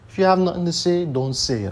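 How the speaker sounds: heated rant at loud-mouthed podcasters; angry outburst. Real, not acted. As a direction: tell them off, really fed up. Scolding people who trash Carnival.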